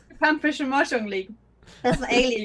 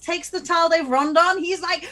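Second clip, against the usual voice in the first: normal talking voice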